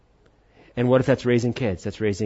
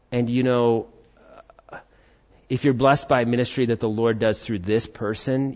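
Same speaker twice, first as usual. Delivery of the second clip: ordinary delivery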